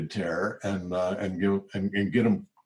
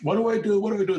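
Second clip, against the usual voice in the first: much higher, fretful repeated question; mock panic; 12 semitones higher, much faster than usual. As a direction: play a lost worker asking helplessly. Grumbling staff panicking.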